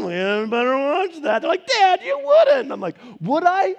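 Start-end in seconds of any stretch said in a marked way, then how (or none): 0.1-1.4 put-on threatening dad voice; mock threat. Dad threatening his sixteen-year-old, mock menacing. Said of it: "I threaten my kids now". 1.7-2.7 put-on high, loud, drawn-out teen protest; protesting kid. Teen to threatening dad, mock disbelief. Voices his teenager protesting. 3.2-3.7 high, loud mock-menacing question; teasing challenge. Dad to doubting kid, teasing threat.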